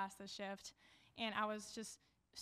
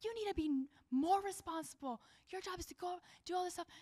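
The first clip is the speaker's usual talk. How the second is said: high, loud, furious tirade; imitating an irate woman yelling at a lifeguard, high and loud; angry customer; 8 semitones higher, 3 dB louder than usual. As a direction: berate the lifeguard, voice raised high. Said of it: "She's like".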